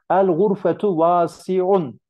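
"El gurfetu vasiun" slow, high and loud; wrong form demo. Shown as a demonstration. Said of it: "çöptür bu cümle". (yanlış cümle olarak "El gurfetu vasiun" yavaş, yüksek ve güçlü vurguyla)

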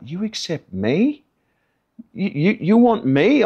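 high, slow, incredulous questions; re-enacted overwhelmed astonishment; stunned disbelief. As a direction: stammer in amazement at being wanted. His overwhelmed reply to the Lord.

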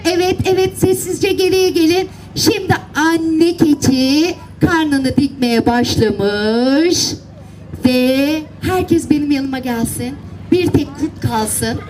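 usual speaking voice

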